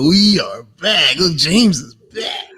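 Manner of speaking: slow, lightly mocked hype intro; a hint of the booming announcer; imitating the intro. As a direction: tease him by parroting his entrance. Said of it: "We're like".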